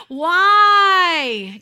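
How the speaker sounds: loud, high wailing whine; whiny voice. Acted. Eli confronts his sons in the temple, whiny. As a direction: stretch it into a helpless wail. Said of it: "I'm putting my whiny voice on".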